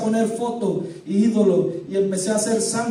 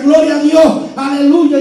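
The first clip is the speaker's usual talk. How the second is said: rejoicing church ladies; 5 semitones higher, 7 dB louder, slower than usual. Retold or imitated.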